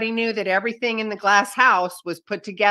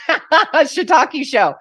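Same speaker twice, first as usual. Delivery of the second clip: laughing; 8 semitones higher, 8 dB louder than usual. Real, not acted. Host laughing at her own euphemism while telling her story, louder and higher. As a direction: crack up as you swap in the pun.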